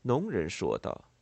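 plain reading voice

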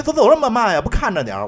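尖声质问的女人; high, loud mock complaint; mock indignant scold. Retold woman scolding after falling. (模仿摔倒的女人尖声抱怨)

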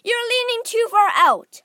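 high, loud alarmed warning; father yelling to his son in danger; shouted. A narrator's reading. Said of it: "shouted Mister Gloop".

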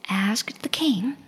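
plain reading voice

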